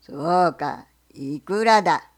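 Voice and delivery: low, loud, slow gruff question; bear. Acted. Bear asks the fox what he sells, low and loud.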